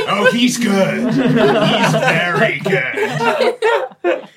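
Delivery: slow words through laughter; laughing. Real, not acted. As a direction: admire it while cracking up. Laughing at player's antics.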